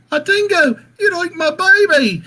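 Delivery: high, loud mock Australian accent; hammed-up Australian shriek of the famous line; Australian accent impression. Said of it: "what's the dingo one?".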